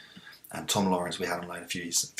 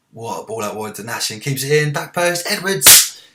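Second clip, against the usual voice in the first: building to a high, full shout; commentary climbing to a roar on the scorer's name; excited goal call; 4 semitones higher, 14 dB louder than usual. Real, not acted. Live match call, goal scored.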